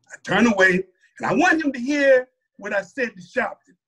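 ordinary delivery

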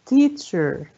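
"teacher" as TEA-cher, falling; stress on first syllable. Shown as a demonstration. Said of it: "على أول مقطع برضو".

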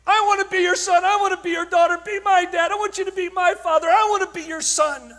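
loud, high pleading cries; screaming boys. Retold or imitated. Young men crying out to the speaker, loud pleading. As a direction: shout it as a crying young man begging. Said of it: "were screaming".

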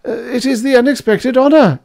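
very high, loud flustered reply; nervous stammer. A narrator's reading. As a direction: answer the angry professor in a flustered high voice. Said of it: "stammered Spiegel".

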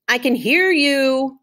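a slow, drawn-out mock holler back. Opal calls back to the boys behind her in a slow holler. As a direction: call it back slowly, as if you really can hear them. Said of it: "I hollered back at them".

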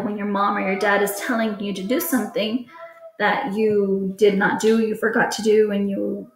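usual speaking voice

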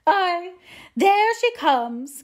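high, loud, drawn-out grievance; complaining mother. A narrator's reading. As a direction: announce her bitterly, high and slow. Narrator voices the complaining mother.

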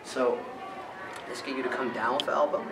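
normal talking voice

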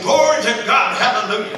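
high, loud glad praising; joyful praise. Retold or imitated. Mexican lady praising God loudly in tongues. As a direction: cry out glory with joy. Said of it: "She was saying".

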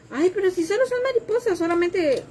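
put-on a high, offhand voice for the girl who makes it sound easy; dismissive girl. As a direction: lightly mimic a careless customer, pitched high. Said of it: "Y me dijo".

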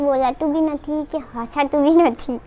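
barely moving lips; tight-faced careful speech; speaking with stiff dried mask. Real, not acted.

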